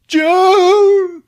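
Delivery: high, drawn-out yell; hammed-up loud wail of a name; desperate calling. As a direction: call out for a lost wife. Husband calls for his wife.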